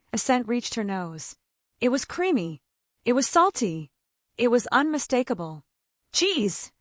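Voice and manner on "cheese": a bright, perky cry of discovery; an eager little exclamation at the smell; perking up. Acted. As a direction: pounce on the word with sudden delight. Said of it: "perking up".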